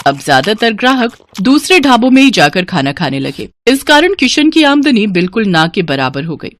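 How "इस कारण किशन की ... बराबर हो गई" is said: usual speaking voice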